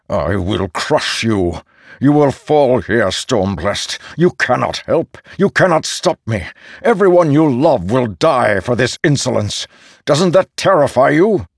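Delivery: loud, high menacing threat; barbarian threat. Acted. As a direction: roar threats at him. Ishar threatens, hand at throat.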